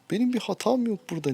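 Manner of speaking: high, loud, hurried self-defence; exasperated protest. Real, not acted. Player insists the loss is not his fault, exasperated. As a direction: protest your innocence fast and high. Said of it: "Vallahi çıldıracağım şimdi ya".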